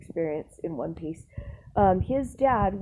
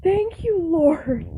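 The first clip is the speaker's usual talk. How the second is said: whispered thank you, then a loud high Lord; relief; 9 semitones higher, 7 dB louder, much slower than usual. Retold or imitated. Worker relieved she isn't fired, thanking God. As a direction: breathe out in sheer relief. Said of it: "And I'm like".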